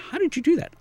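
imitating people amazed at a rescue, high and incredulous; amazed onlookers. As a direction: ask it like a stunned bystander. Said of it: "people going".

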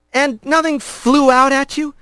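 put-on a boy rat asking carefully; young rat. A narrator's reading. Young rat questioning the stern father, nervous and high.

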